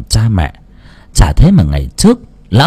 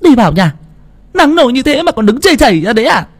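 put-on high, fast scolding old woman; grandma scolding; 13 semitones higher, faster than usual. A narrator's reading. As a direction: snap at her like a cross grandmother. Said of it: "Bà quát". (bà già quát mắng, giọng cao và nhanh)

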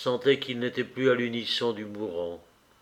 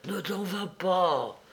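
weak, slow plea, higher and softer; dying man's plea; 5 semitones higher, 6 dB quieter, slower than usual. A narrator's reading. Dying man to his brother, soft high plea. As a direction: plead softly and slowly, voice raised in pitch.

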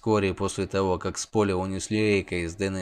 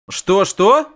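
sharp jealous double question, high and loud; surprised; 11 semitones higher, 9 dB louder than usual. Acted. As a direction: snap it out, suspicious and startled. Ревнивый вопрос Йеннифер. (резко и подозрительно переспрашивает)

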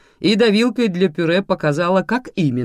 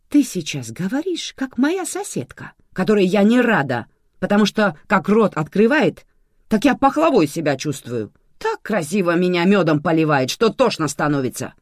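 higher, exasperated brush-off; dismissive; 6 semitones higher, 5 dB louder than usual. A narrator's reading. Manana brushes off Sergo's sweet talk, dismissive. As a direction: wave the flattery away impatiently. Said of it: "отмахнулась Манана".

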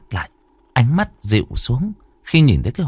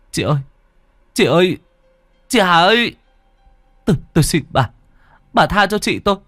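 pleading cry; 11 semitones higher than usual. Acted. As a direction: beg the demon to spare her. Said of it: "gào thét". (van xin, gào cao và to)

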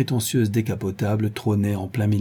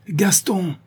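shout; 9 semitones higher than usual. Acted. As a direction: yell the caretaker's name, higher. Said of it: "hurla alors Madrier".

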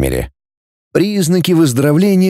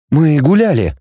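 ordinary delivery

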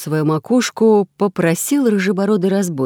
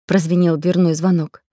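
plain reading voice

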